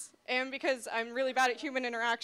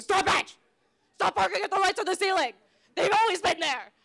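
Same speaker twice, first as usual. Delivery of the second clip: higher, loud exasperated yell; yelling dad; 5 semitones higher, 8 dB louder than usual. Acted. Dad yelling at the barking dog, loud scolding. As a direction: shout like an irritated dad at the dog. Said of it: "and my dad goes".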